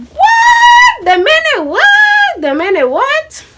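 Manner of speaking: very high, drawn-out squeal of disbelief; incredulous shriek. Retold or imitated. Hearing friend is dating Reek, acted-out incredulous outburst. Retells shocked reaction to dating news.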